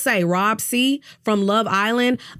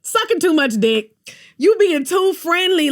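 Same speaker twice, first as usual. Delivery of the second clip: louder, higher mock accusing players; higher, louder mock group accusation; 6 semitones higher, 4 dB louder than usual. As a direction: bark it at her like the group. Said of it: "They was like".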